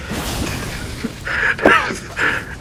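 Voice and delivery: squealing laugh; high wheezing laugh. Real, not acted. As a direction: crack up at the image. Picturing the beatdown video.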